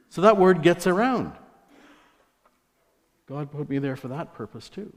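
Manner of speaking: ordinary talk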